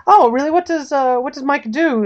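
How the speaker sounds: high-pitched, inquisitive reporter; feigned interest. Acted. Woman posing as reporter probes the butler, feigning interest. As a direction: pitch it up, fishing for information.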